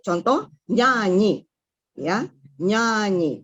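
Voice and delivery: slow "nyanyi" with the weight on the first "nya"; stress on NYA. Shown as a demonstration. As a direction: stretch "nyanyi" and lean on "nya". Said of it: "Jadi tekanannya di nya".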